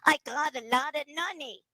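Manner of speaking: "nunny" in the nasal dummy voice. Shown as a demonstration. Teacher modeling the line for students to repeat. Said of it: "Try that".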